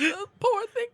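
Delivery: very high mock-pitiful voice; feigned distress. Fake victim works on the guard's sympathy, feigned distress. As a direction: ham up the pity, very high.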